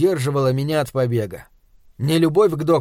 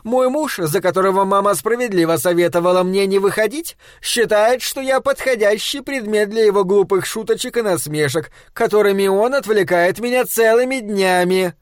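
complaining wife; 5 semitones higher than usual. Acted.

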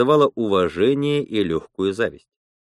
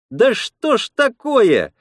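high, drawn-out indignant exclamation; exclaiming; 11 semitones higher, 3 dB louder, slower than usual. A narrator's reading. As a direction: cry out in dismay, pitched way up. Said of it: "воскликнула Лена".

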